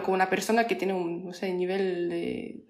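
normal talking voice

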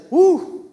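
a high, quick whoop of joy; ecstatic woo. Retold or imitated. Driver lost in worship lets out a high whoop. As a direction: let a sudden woo fly up high. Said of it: "you'll say".

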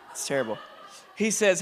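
ordinary delivery

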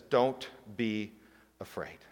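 fading soft comfort; gentle reassurance. Retold or imitated. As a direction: say it softly to a scared friend. Quoting Jesus' first phrase.

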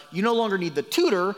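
ordinary talk, nothing marked

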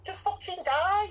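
high, slow, exasperated jab; ranting about a film character. Real, not acted. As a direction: throw it up high in disgust. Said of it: "ranting at Michael".

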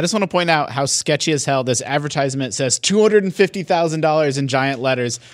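normal talking voice

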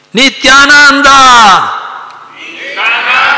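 very high, loud, long-drawn devotional cry; shouting the name. Acted. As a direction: cry out the holy name at full voice, stretching it. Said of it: "gridava a gran voce il nome di". (grido a gran voce, prolungato)

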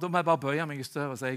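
usual speaking voice

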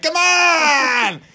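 high, loud, drawn-out yell; exasperated mock bellow; worked-up shout. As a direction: holler it in a put-on accent, all worked up. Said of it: "every time I get worked up".

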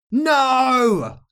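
high, loud drawn-out wail; hammed-up wicked witch despair; witch's anguished cry. A narrator's reading. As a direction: scream it like a defeated villain.

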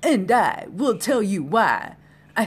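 lightly hammed-up, halting declamation; slightly stagy, swooping speech; theatrical orator. Launches into theatrical speech after naming Shatner.

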